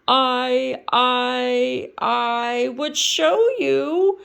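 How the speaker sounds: slow stuttering start. Acted. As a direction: stammer over the words. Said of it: "Kermit stuttered".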